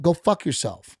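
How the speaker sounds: usual speaking voice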